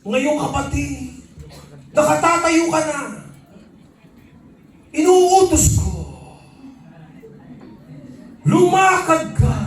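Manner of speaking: hammed-up miracle-worker voice; commanding faith healer. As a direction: build from solemn address to shouted command. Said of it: "Sabi ng faith healer".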